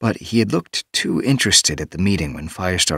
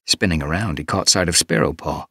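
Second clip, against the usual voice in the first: plain reading voice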